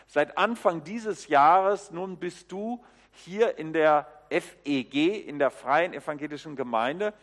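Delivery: ordinary talk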